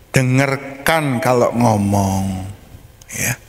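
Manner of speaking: normal talking voice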